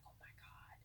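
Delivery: hushed, stunned cashier whisper; a soft, slow astonished whisper; amazed whisper. Retold or imitated. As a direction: breathe it out in disbelief. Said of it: "she was like".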